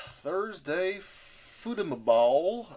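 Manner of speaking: slow, high sportscaster call; drawn-out announcer call. Pretend. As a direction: stretch it like a TV announcer.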